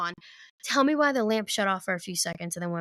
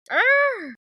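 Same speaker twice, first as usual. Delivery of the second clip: high, loud growling cry; imitated growl noise; 11 semitones higher, 8 dB louder than usual. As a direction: let out a loud startled arr. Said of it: "did he really make that noise?".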